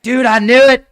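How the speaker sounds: conspiracy fan crowing over proof; mock excited shout. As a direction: shout it like you caught him. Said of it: "they'll be like".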